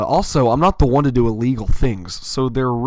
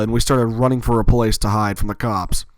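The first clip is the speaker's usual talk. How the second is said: usual speaking voice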